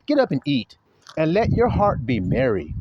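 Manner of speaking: usual speaking voice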